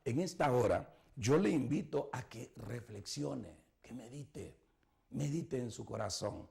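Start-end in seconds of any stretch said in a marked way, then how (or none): 2.1-6.5 hushed, gentle call to reflect; quiet altar-call voice; soft reflective invitation. Acted. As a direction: speak low and tender.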